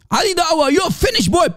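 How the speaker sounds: high, mocking taunt; mock Hijab taunt. Mocking the debater, a high sneering taunt. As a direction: sneer it like a bully. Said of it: "he said".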